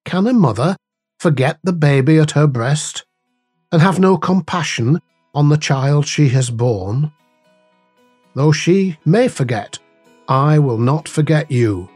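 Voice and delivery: ordinary talk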